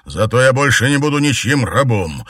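high, loud, spiteful snarl; angry. A narrator's reading. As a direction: spit it out with spite. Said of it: "злобно произнёс император".

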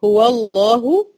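هو الله with a full heavy lam in الله; heavy lam in Allah. Shown as a demonstration. Teacher showing girls the heavy lam in الله. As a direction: say الله thick and slow after هو. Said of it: "زي قل هو الله أحد".

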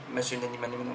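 ordinary delivery

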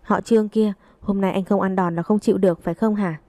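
plain reading voice

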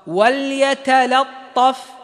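وليتلطف very slow, ta heavy and lam light; heavy ta only. Shown as a demonstration. Teacher showing the correct وليتلطف, very slow. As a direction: drag out وليتلطف, give only the ta full weight. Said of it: "فخم الطاء لوحدها".

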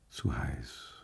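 quiet murmur. Acted. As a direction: sink into the heat, barely voiced. Inner thought, drowsy from wine. (leise, tiefer gemurmelt, schläfrig)

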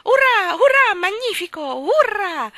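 very high, loud joyful shrieks; shrill cry. Acted. Old woman cries hurrah with a shrill little voice. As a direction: squeal with delight while dancing. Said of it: "gridò con la sua vocetta stridula".

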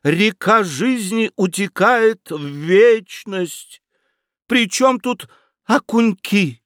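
high, slow earnest pleading; lofty young dreamer. A narrator's reading. Young idealist explains life's meaning to aunt, high and slow. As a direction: preach the meaning of life, slowly.

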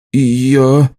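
ordinary delivery, nothing marked

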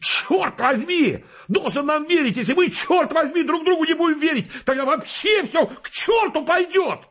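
high, frantic shouting rant; furious yelling. Acted. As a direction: yell it at him in a fury, pitch way up. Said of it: "Он так бешено орал и брызгался". (бешеный крик)

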